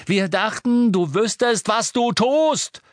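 high, loud furious shout. A narrator's reading. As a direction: yell it at him, dragging the last word. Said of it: "schrie Ron".

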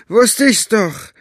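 high, slow, loud gloat; triumphant rogue. Acted. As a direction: savour being proven right. Staubfinger triumphant.

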